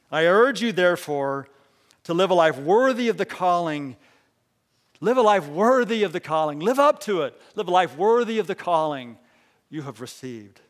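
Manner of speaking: loud, rising exhortation; loud, ringing pulpit exhortation; full-force preaching. Acted. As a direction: urge them on from the pulpit.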